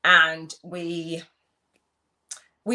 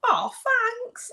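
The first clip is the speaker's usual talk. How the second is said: squeaky startled thanks; high, startled little thank-you; surprised high squeak. Retold or imitated. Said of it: "I was like".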